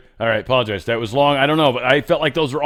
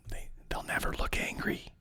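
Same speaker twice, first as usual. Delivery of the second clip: a whispered aside; 13 dB quieter than usual. Real, not acted. Vlogger to viewers about the Brits, whispered. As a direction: lean in and whisper it. Aside after mock offer.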